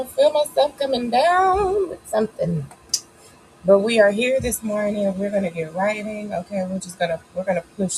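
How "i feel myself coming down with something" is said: higher, louder, slow dramatic complaint of feeling ill; milk the sniffles for sympathy; mock-dramatic under the weather; hammed-up sick voice. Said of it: "I feel it, y'all".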